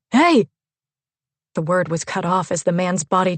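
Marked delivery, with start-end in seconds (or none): 0.1-0.5 sudden loud high yell. A narrator's reading. Gunman yells at Leonard as he's shot, loud startled yell. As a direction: shout a sharp warning, startled. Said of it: "the man yelled".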